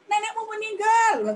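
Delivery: high, loud cheerful tone for sad news; wrongly cheerful. Shown as a demonstration. Teacher showing the wrong tone for bad news. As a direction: cheer it out as if it were good news. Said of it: "Gak mungkin kan kalau kita menyampaikan berita duka".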